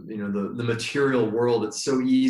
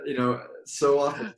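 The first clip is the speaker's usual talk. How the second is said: normal talking voice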